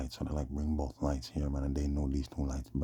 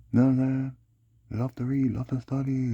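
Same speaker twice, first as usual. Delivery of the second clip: excited; 10 semitones higher, 7 dB louder, slower than usual. Real, not acted. Praising her, voice raised.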